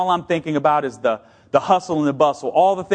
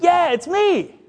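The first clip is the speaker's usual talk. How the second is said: high, bright "yeah, it's me"; young man answering; 13 semitones higher, slower than usual. Retold or imitated. Grown-up former kid greeting his old mentor, high voiced. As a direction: answer like you're thrilled to be recognized. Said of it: "and he's like".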